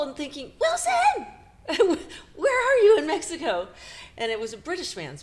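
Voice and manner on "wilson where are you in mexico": high, loud, laughing greeting; eager laughing hello on a long-distance call; excited, laughing. Retold or imitated. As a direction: call out his name with delight. Happily excitedly answered call.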